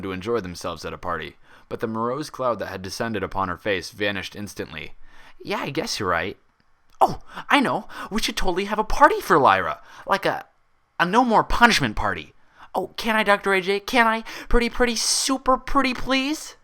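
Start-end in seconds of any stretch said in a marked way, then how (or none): 5.4-16.6 high, loud, rising squeals of pleading; excited begging. Acted. Pinkie begs Applejack for a party, squealing high and loud. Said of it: "Pinkie Pie perked up".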